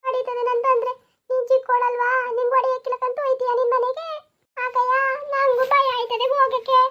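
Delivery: lightly voiced frightened girl; scared girl. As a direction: fret about going home. Frightened girls continue.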